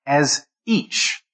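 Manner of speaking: "each" set apart and weighted; stress on each. Shown as a demonstration. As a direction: land firmly on "each". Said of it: "the emphasis in this point is each".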